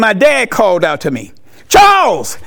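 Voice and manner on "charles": higher, louder call across the house. Retold or imitated. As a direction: call the boy's name to get his attention. Dad calling out to boy.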